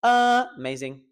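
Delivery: "amazing" with A high and loud; stress on A. Shown as a demonstration. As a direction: misplace the stress, A-mazing. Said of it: "I find the stressed syllable".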